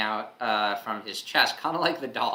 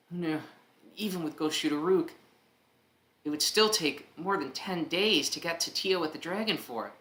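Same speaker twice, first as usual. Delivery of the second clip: character voice; 6 semitones higher than usual. Acted. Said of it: "I'd love to do a German accent".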